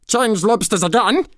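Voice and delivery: high-pitched yelled order; excited yell. A narrator's reading. Gryphon calling the next lobster move, yelling. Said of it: "yelled the Gryphon".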